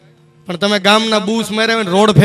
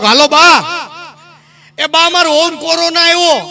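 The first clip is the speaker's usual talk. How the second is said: uncle on phone; 7 semitones higher than usual. Retold or imitated.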